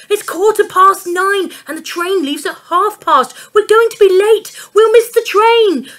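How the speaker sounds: high, loud panicked cry; shout. A narrator's reading. Dad shouting at his watch in a panic.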